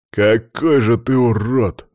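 loud, drawn-out indignant exclamation. A narrator's reading. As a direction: exclaim it slowly and forcefully. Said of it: "воскликнул Макс".

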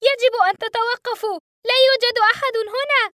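high, loud shout through the door; frantic shout. A narrator's reading. Boy shouting at the cockpit door, frantic. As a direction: bang and yell at the pilots. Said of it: "ثم بقوة أكبر صائحًا".

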